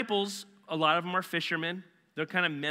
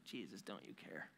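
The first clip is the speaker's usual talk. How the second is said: hushed plea; 6 semitones lower, 20 dB quieter than usual. Retold or imitated. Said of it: "I'm like".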